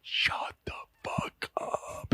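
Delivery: slow, drawn-out whisper; whispered warning. Retold or imitated. Publicist warns chef to stop talking, slow whisper. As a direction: whisper it like a secret urgent order.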